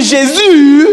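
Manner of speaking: high, loud, long-held "Jésus"; exclaimed intonation. Shown as a demonstration. Teacher showing intonation to a class, loud drawn-out cry. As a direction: cry out Jésus, stretching the end. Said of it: "Ça, c'est l'intonation".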